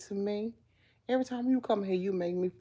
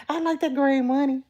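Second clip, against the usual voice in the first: loud, high-pitched insistence; emphatic repeat; 8 semitones higher, 6 dB louder than usual. Real, not acted. Telling chat she likes money, emphatic repeat. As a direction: drive it home, higher and louder.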